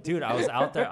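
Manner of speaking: loud, high words through real laughter; loud, laughter-filled retelling; laughing protest. Real, not acted. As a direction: protest while cracking up. Laughing, protests neighbors' account.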